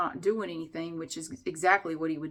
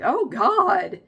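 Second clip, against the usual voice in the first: mock horrified; 7 semitones higher, 8 dB louder than usual. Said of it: "I was kinda horrified".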